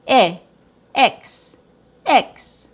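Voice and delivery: "e" short, then "x" as a hissed ks; sounding out i-ks. Shown as a demonstration. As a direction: split it slowly. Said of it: "short I sound plus K S".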